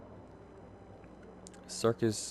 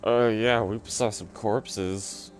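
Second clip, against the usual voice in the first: mock awkward fib; 5 semitones higher, 4 dB louder than usual. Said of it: "It's like".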